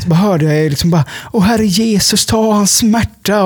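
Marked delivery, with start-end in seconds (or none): 1.3-3.4 higher, drawn-out pious prayer; praying church lady. Retold or imitated. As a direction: pray over him like a church lady, slowing at the end. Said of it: "en sån typ Jesus-tant". (hög, utdragen bön som en Jesus-tant)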